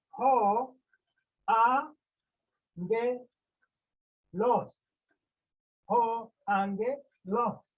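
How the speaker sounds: "Ho, a, nge, los" in separate, loud syllables; syllable-by-syllable pronunciation. Shown as a demonstration. Teacher adding an accent to pronounce the noun, syllable-by-syllable pronunciation. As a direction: sound out "Ho, a, nge, los" piece by piece, firmly. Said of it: "to pronounce".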